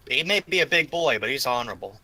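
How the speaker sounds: ordinary talk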